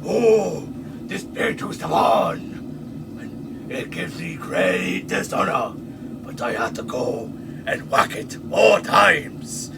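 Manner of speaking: hammed-up Japanese accent, solemn complaint; exaggerated Japanese accent, drawn-out last words; mock Japanese accent. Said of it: "what a Japanese accent is".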